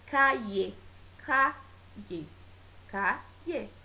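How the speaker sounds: "calle" split as ca... lle; "calle" in two separate beats, ca, lle; two syllables, ll undivided. Shown as a demonstration. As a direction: pause before the ll in "calle". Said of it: "não há como separar o som".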